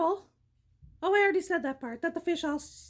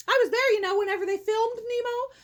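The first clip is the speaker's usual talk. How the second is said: usual speaking voice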